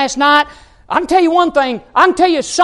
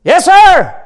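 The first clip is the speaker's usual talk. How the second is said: raised, emphatic yes sir; imitated congregant call; 6 semitones higher, 7 dB louder than usual. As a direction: mimic a worshipper calling out with gusto. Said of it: "some were".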